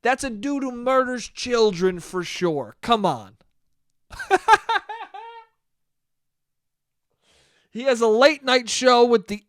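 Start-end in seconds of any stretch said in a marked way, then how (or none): 4.1-5.4 high laugh. Real, not acted. Laughing at his own joke.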